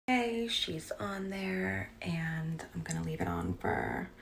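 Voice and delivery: slow soft whisper; hushed, almost secret. Real, not acted. Narrating the dye to viewers in a whisper.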